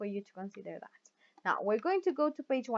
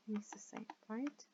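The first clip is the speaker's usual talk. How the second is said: whisper; 17 dB quieter than usual. Real, not acted.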